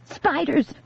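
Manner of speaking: high urgent cry; shouted warning. A narrator's reading. Grace scaring the girl with a high urgent cry. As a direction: sell the panic in one word. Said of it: "Grace shouted again".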